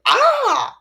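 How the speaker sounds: high, loud imitated shriek; mock scream. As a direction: squeal like the startled girl. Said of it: "her was like".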